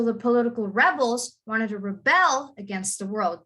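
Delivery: "rebels" stressed on REB, "rebel" on BEL; slow demo, punching REB in "rebels" and BEL in "rebel"; exaggerated noun-verb stress. Shown as a demonstration. Teacher explaining to a latecomer, stressed first in "rebels", last in "rebel". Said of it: "Do you see how I'm emphasizing reb".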